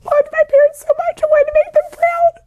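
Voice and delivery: high, tear-choked voice breaking; real crying. Real, not acted. Guest crying as she tells the host about her dad, voice breaking. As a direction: push the words out through real tears. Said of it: "I just started bawling".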